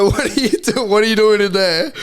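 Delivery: high, hammed-up Australian guard voice; mock-indignant challenge in exaggerated Australian; thick Australian accent. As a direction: mimic the guard in broad, high-pitched Aussie. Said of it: "In a thick Australian accent".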